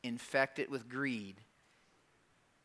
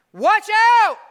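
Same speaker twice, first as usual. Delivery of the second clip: a sudden, much higher and louder "Watch out!"; yelled warning; 14 dB louder than usual. Shown as a demonstration. Preacher yelling Jesus' warning at the congregation, full shout. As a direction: shout it like a real alarm. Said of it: "And I yelled it for a reason".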